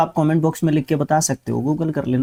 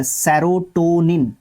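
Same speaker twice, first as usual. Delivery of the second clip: slow, careful; 3 semitones higher, much slower than usual. Shown as a demonstration. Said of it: "उसका सही spelling आपको देखने को मिलता है".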